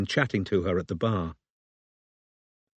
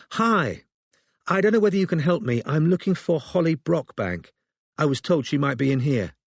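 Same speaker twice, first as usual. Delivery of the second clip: bright, quick, higher put-on confidence; forced confidence; 6 semitones higher than usual. A narrator's reading. Robin to the barmaid, bluffing with forced confidence. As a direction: sound sure of yourself while nervous. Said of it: "with an air of forced confidence".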